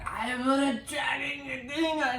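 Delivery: high, strained shouty whine; hammed-up desperate excuse; mock frantic protest. Voicing Reva protesting to Vader.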